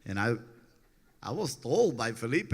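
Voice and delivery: normal talking voice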